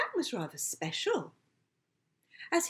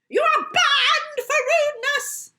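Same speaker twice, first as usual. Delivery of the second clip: high, loud shriek of an outraged shop owner; shrieking shopkeeper; 11 dB louder than usual. Acted. Shopkeeper shrieks at the dog who wet her leg. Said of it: "shrieked a pointy-fingered".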